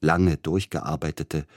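usual speaking voice

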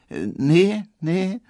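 a put-on girlish maid; coy maid Pauline. A narrator's reading. Pauline voiced by narrator.

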